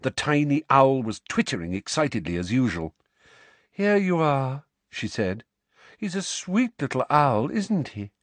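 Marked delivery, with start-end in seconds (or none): 3.7-4.6 put-on higher, airy dreamy girl voice; dreamy Luna. Luna hands over owl. 6.0-8.1 put-on higher, airy dreamy girl voice; dreamy Luna. Luna coos about owl.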